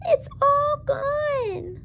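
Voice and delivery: very high squeaky little bear; high squeaky cub. A narrator's reading. Baby Bear's squeak continues.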